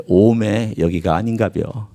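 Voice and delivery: slow, drawn-out Jeolla dialect. Shown as a demonstration. Preacher quoting a saying for wrong endings, Jeolla dialect. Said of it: "전라도 사투리로".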